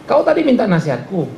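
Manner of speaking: normal talking voice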